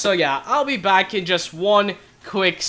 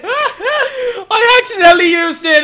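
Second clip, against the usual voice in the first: very high, loud wail of dismay; anguished shouting; 11 semitones higher, 10 dB louder, slower than usual. Real, not acted. Player who accidentally used the recall potion, anguished shouting. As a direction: scream at your own blunder, half laughing.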